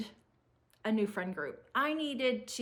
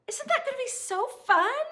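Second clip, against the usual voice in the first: put-on 1 excited teen; 10 semitones higher, 5 dB louder than usual. Teen daughter's excited vacation plea.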